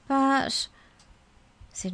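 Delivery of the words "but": high, anxious objection; trembling protest. A narrator's reading. Caged Mallory trying to argue, trembling protest. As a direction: object in a high, worried little voice. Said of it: "Mallory couldn't stop trembling".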